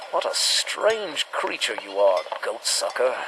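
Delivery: low, soft, wondering murmur; hushed musing. Acted. Hunter murmuring over a drained goat, low and hushed. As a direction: murmur it to yourself over the carcass.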